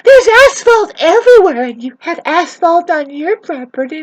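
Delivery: high, loud mock-hysterical imitation; mocking the neighbours. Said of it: "these people are saying".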